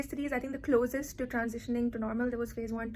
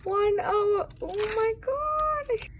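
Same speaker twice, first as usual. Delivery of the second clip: frustrated; 8 semitones higher, 7 dB louder, slower than usual. Real, not acted. Stuck on a question for hour.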